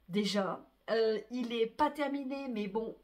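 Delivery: ordinary talk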